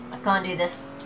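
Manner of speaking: usual speaking voice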